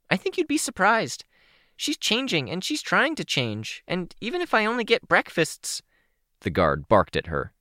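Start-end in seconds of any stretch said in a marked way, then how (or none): 0.1-5.8 put-on high, bubbly teen girl voice; a much higher, chirpy young girl; bright young girl voice, a little shy; Kenzie's voice. Kenzie speaks.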